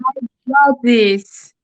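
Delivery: slow, firm affirmation; mean every word of it; said like she means it. Real, not acted. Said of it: "say it like we mean it".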